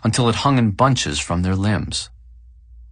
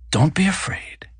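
soothing whisper; 9 semitones higher than usual. A narrator's reading. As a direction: whisper "afraid" gently to calm him. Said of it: "His voice was soothing".

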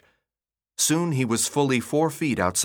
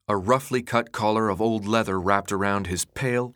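usual speaking voice

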